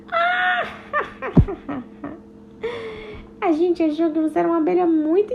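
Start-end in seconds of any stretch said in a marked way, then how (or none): 0.1-0.6 very high delighted cry; high little shriek; excited squeal. Acted. Teca squeals. 0.7-1.8 light happy giggle; little giggling laugh. Acted. Laughing together.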